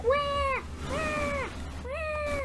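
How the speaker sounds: high mock crying wahs; mock wailing. Story character crying like a baby, high mock wail.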